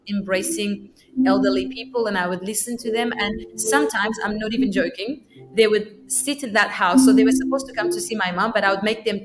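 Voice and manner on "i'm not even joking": ordinary delivery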